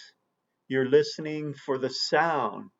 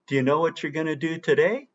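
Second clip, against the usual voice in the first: quick, run-together "Do you know what you're gonna do today"; fast connected speech; 3 semitones higher, much faster than usual. Shown as a demonstration. Teacher shows students the fast weak-form version of the sentence. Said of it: "how about the other sentence".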